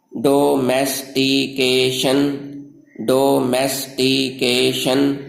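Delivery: Domestication slow, in five drawn-out beats; syllable by syllable. Shown as a demonstration. Teacher models domestication for learners, slow syllable by syllable. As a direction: sound out domestication one stretched syllable at a time. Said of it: "पांच हरकतों से अदा होते हैं".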